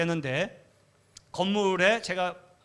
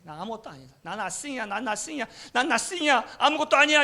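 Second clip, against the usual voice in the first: building despairing cry; 4 semitones higher than usual. Retold or imitated. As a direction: let the despair swell into a cry. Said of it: "이러면서 하나님께 막 원망을 하면서 눈물 흘리면서".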